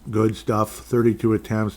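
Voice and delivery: ordinary talk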